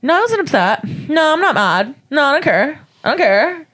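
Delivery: high sulky mock denial. Heckler denying being upset, high sulky mock voice. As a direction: play the pouting girl insisting she's fine. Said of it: "She's like".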